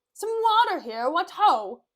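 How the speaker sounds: high, loud theatrical summons; calling out. Acted. Petruchio summoning servants with water, calling loudly. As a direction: holler for service across the hall.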